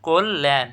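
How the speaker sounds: কল্যাণ as "kol-len"; correct pronunciation. Shown as a demonstration. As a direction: say কল্যাণ with an o, doubled l and "e" ending. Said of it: "কী হবে?". (কল্যাণকে "কোল্-লেন" উচ্চারণ)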